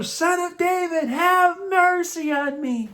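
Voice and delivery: very high, drawn-out yell for mercy; full-out shout, stretching each word. A narrator's reading. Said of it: "louder and louder".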